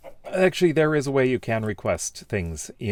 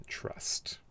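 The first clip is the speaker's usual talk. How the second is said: whisper; 12 dB quieter than usual. Acted. Joking comment read out.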